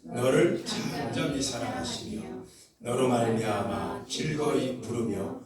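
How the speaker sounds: slow, measured recitation; congregational Scripture reading. Acted. Preacher leading the congregation in reading the verse together, measured recitation. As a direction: read the verse out in slow, stretched phrases.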